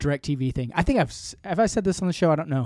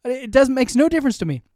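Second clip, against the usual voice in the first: acting out a heated brush-off, pitched way up; retelling how he told off the collections agent, much higher and defiant; defiant mock yell; 10 semitones higher than usual.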